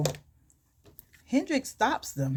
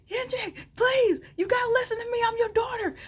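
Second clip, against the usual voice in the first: retold screaming plea. Daughter begs Kendrick while dragged away by security, mock screaming. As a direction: act out desperate pleading way up high. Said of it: "And I'm screaming".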